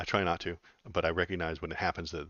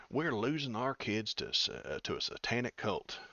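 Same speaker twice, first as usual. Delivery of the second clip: slow, higher-pitched Southern drawl; 4 semitones higher than usual. Acted. Reading a headline to chat in a mock Southern voice. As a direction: put on a Southern accent, drawing it out. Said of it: "put on my southern voice".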